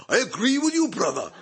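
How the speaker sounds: loud, laughing, high call from the crowd; loud heckler's reply. Retold or imitated. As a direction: shout the agreement with a laugh. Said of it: "A voice said".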